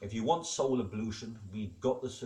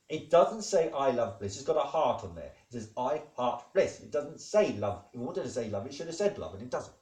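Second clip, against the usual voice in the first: higher, louder pedantic protest; emphatic insistence; 4 semitones higher, 5 dB louder than usual. Real, not acted. Speaker corrects the wording on the gowns, emphatic insistence. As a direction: hammer the point home, exasperated.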